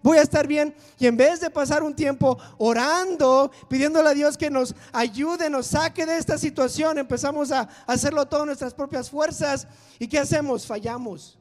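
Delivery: loud, driving sermon voice; full-force preaching. Acted. Preacher urging his church to pray, loud and driving. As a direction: preach it to the back row.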